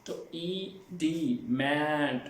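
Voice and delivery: "ई डी" said very slowly, then "mind"; syllable breakdown. Shown as a demonstration. Teacher breaking demand into syllables for students, slowly. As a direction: split demand into slow parts, leaning on "mind". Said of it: "इनमें stress करना है".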